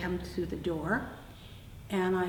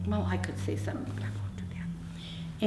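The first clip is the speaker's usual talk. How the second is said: softly whispered, much lower and trailing; whispered aside; 12 semitones lower, 3 dB quieter than usual. Real, not acted. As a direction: bite your tongue in a whisper. Holding back a remark.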